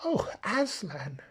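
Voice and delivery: high, slow plea of despair. A narrator's reading. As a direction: cry out his name in dismay. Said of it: "both together in despairing voices".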